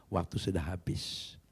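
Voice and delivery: breathy whisper, slow and soft; whispered aside. Retold or imitated. Preacher whispering that his time was gone. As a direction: let the words fall to a whisper.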